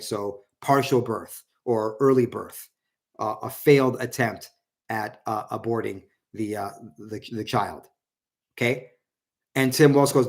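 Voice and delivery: ordinary delivery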